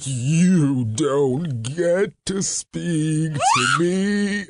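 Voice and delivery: very slow, hammed-up shout; dramatic movie line. Retelling the film's tearful scene, slow mock shout. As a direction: stretch it out like a melodrama heroine. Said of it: "is like".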